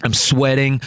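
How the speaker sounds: plain reading voice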